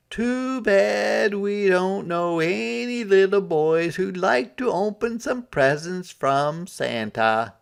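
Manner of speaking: high, slow sing-song mother voice; mom voice. A narrator's reading. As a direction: tease him like a knowing mom. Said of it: "said Harold's mom".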